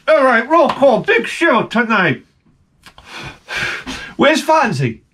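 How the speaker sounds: high-pitched mock director; show director voice. Director summons the cast for tonight's show, high calling voice. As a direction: call out the roll before showtime. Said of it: "the director was like".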